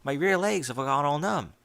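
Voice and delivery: ordinary delivery, nothing marked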